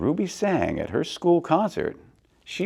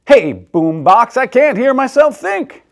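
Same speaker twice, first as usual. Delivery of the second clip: annoyed shout; 11 semitones higher, 9 dB louder than usual. Acted. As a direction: yell over the noise.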